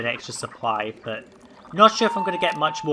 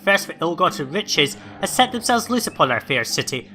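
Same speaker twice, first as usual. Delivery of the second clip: raised, louder proclamation of doom; dramatic reading; 5 semitones higher, 4 dB louder than usual. Acted. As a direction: read the alert like a town crier. Reads the in-game crime alert.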